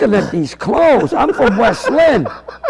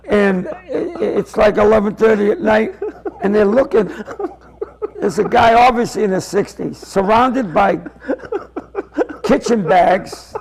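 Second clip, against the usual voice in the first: ordinary delivery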